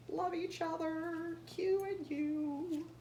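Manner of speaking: sweet high read. Acted. Reading cover text in sweet voice.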